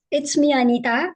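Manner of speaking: normal talking voice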